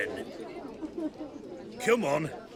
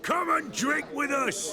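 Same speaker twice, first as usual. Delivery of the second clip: shouted invite; 9 semitones higher than usual. Acted. Bandit inviting to drink.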